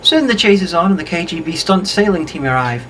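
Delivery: ordinary delivery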